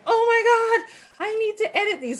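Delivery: very high, hammed-up dismay; mock panic. Photographer retelling horror at background people, mock panic. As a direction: wail it high, acting out horror. Retold horror at ruined wedding shots.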